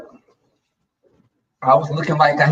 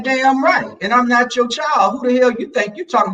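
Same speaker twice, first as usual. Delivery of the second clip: sassy telling-off; 7 semitones higher than usual. Retold or imitated. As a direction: put him in his place, neck rolling. Telling off abusive boyfriend.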